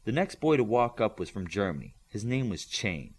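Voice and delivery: ordinary delivery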